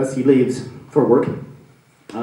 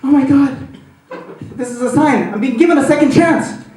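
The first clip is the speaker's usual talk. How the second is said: high, loud elated gasp; thrilled; 9 semitones higher, 4 dB louder than usual. Retold or imitated. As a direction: grab at the sign you were given. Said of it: "And he's like".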